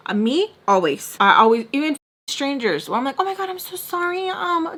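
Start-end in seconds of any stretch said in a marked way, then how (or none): 3.2-4.8 high, hurried apologetic babble; over-apologizing. Acted. Over-explaining to strangers, high apologetic babble. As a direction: gush apologies in a much higher voice. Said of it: "over explains themselves to me".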